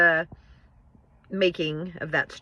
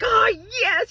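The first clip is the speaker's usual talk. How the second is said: squeaky high burst of joy, much higher; a triumphant yelp he tries to hold back; barely contained glee. Retold or imitated. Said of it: "trying to contain his excitement".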